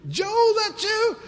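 high, slow sleepy voice; lightly voiced drowsy friend. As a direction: call out groggily from bed. Friend answering from inside.

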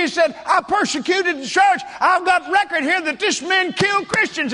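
high, loud accusing shout; worked-up preacher crying foul; indignant outcry. Retold or imitated. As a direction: pounce on a caught lie, loud and high. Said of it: "I jumped up, and I said".

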